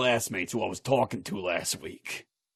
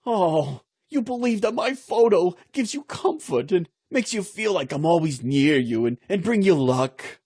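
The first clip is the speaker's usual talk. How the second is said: excited wife; 6 semitones higher than usual. Retold or imitated. Wife thrilled husband carries her photo, excited high gushing. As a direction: pitch jumps high, bright gush of delight. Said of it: "the wife gets all excited and says".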